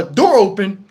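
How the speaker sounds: ordinary delivery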